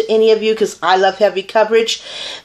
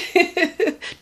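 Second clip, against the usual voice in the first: quick high giggle; 8 semitones higher, 5 dB quieter than usual. Real, not acted. Laughs at own boast.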